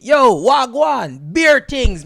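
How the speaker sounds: loud, high, drawn-out mock greeting; hearty greeting. UK Jamaican leading with Jamaican greeting, hearty. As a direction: act out a big, slow, swaggering hello.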